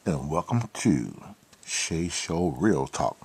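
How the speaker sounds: hushed host voice, inviting people to unwind; smooth show intro. Acted. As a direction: ease the listeners in like a night-time DJ. Late-night show opening.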